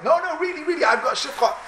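high-pitched, eager insisting; insistent protest. Acted. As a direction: protest that it's really true, voice leaping up. Slave owner insisting to doubting friend.

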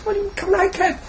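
put-on very high, puzzled little boy voice; a toddler who can't see the problem; small child. Toddler questions his father about the cap, very high and puzzled. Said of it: "And he said".

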